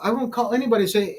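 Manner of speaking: ordinary talk, nothing marked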